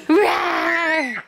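mock roar with a laugh; playful monster roar. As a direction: growl it like a silly monster, laughing. Mom roars as monster at toddler.

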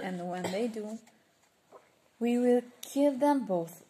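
a loud, fierce vow to kill; rising to a loud threat on the kill. Acted. Murderer vowing to his partners, loud fierce threat. Murderer voiced plotting the killing.

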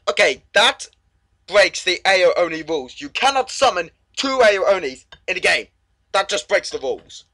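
loud, high outraged complaining; indignant protest. Real, not acted. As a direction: rant at the unfair game. Complains about game rules.

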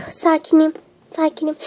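low, softening self-soothing; forced calm. Acted.

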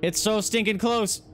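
so close you can't stand it; high excited squeal of suspense; excited tension. Real, not acted. Generator nearly done.

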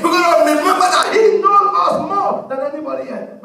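loud, rhythmic declarations of faith; fervent preaching. Acted. Preacher declaring what God Almighty will do, fervent preaching. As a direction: proclaim it to the whole congregation.

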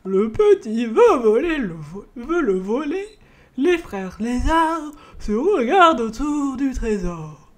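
put-on slow, loud riddling old man; Père Fouras voice. Shown as a demonstration. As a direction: intone like a game show sage. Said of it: "Père Fouras".

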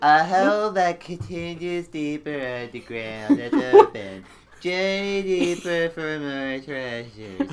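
slow, drawn-out silly voice through laughter; mock-portentous parody of mom; joke mom voice. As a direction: read it out ponderously, cracking up. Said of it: "so I'm talking about my mom".

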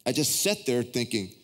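ordinary talk, nothing marked

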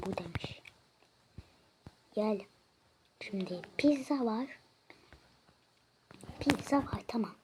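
normal talking voice

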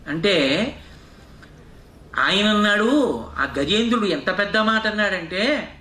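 ordinary talk, nothing marked

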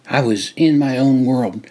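usual speaking voice

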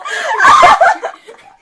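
very high, loud cackling burst; helpless high laughter; shrieking laugh. Real, not acted. Reacting to the fall.

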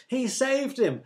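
very high-pitched excited call; eager shout. A narrator's reading. Another in the crowd guesses at Jesus's question, eager shout. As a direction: call out the answer, pitched right up. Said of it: "shouted another".

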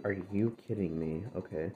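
usual speaking voice

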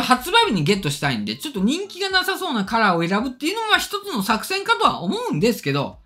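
ordinary talk, nothing marked

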